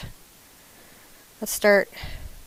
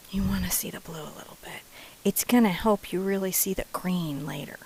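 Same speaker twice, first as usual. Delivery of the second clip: soft conspiratorial whisper; a whispered painting tip to viewers; 6 dB quieter than usual. Acted.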